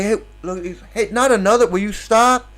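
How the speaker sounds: high, loud comic complaint; mock exasperated protest. Brahma's back head telling another to stop, mock exasperated. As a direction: beg him to stop, pitch jumping up. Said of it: "And he's like".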